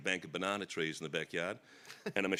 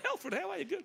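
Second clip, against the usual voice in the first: mimicking a mate. Mimics friend's phone greeting.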